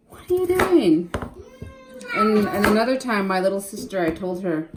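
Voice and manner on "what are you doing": higher, slow mock-exasperated question; retold exasperation. Mother retelling scolding daughter, mock exasperated. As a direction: replay the frustration at the daughter's slow cutting. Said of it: "I got so mad. I was like".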